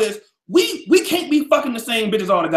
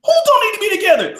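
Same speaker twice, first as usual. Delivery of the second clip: high, loud indignant demand; indignant outburst; 11 semitones higher, 4 dB louder, faster than usual. Real, not acted. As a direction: jump in outraged at the suggestion. Indignant reply to the guest.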